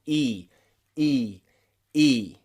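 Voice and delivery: E as "ee" with the mouth spread wide; isolated, held "ee" for each E. Shown as a demonstration. Teacher shows learners the mouth gets wide, long E sound. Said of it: "your mouth gets wide".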